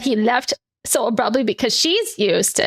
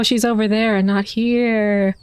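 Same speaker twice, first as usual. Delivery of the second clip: usual speaking voice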